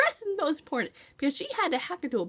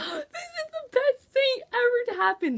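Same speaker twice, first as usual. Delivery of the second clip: overjoyed squeal; 11 semitones higher than usual. Real, not acted. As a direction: squeal like your dream came true. Overjoyed at reunion.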